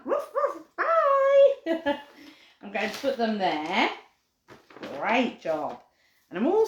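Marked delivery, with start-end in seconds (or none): 0.0-2.0 higher yappy barks, fading to plain; playful dog barks. Pretend. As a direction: bark for the puppy like a game. Dogs saying goodbye.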